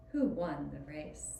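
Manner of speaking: plain reading voice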